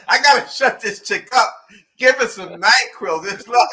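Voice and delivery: hammed-up Ellis losing patience; exasperated mock yell.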